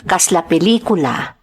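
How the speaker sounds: ordinary talk, nothing marked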